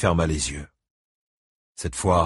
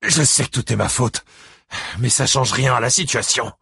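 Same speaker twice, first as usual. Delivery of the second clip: higher, strained protest; arguing back; 5 semitones higher, 3 dB louder than usual. A narrator's reading. Buddy Lee admits fault to Ike, arguing insistently. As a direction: push back urgently while owning the fault. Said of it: "argua Buddy Lee".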